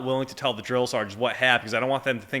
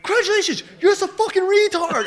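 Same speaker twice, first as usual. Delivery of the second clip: much higher, louder put-on yell; mock drill sergeant scream; 5 dB louder than usual. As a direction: scream it like a drill sergeant gone mad, hammed up. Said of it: "And he's screaming at me".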